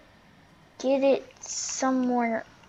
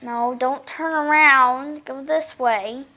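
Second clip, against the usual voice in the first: slow pleading; 6 dB louder, much slower than usual. Real, not acted. Pleading with AI driver.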